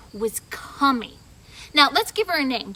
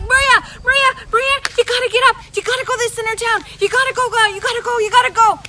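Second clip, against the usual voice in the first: much higher, louder and fast; excited kid; 8 semitones higher, 8 dB louder, much faster than usual. Acted. Kid bursting in, urgently calling Maria to get up. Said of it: "this kid was so excited".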